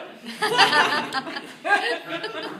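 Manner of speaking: loud, high giggling fit; real laughter =26; burst of laughter. Real, not acted. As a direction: laugh at your own joke. Cracks up at sock joke.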